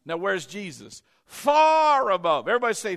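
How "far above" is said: loud, slow proclamation; emphatic preaching. Acted. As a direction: hammer home the phrase. Preaching at full force.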